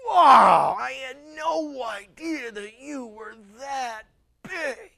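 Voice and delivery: loud gasping wow, then a slow marvel; stunned awe before God; a soul seeing God, loud wow then slow wonder; awestruck. Acted. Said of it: "you're gonna sit down and go".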